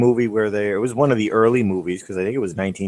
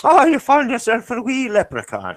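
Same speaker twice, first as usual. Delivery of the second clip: much higher put-on wee Scottish voice; mock Scottish caricature. Teasing his friend in a high mock Scottish voice. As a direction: squeak it out like a cartoon Scot.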